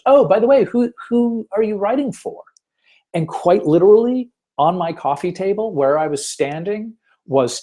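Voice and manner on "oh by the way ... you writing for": marketing guy asking. Retold or imitated. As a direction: pop up brightly, like a friendly marketer checking credentials. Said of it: "And this marketing guy goes".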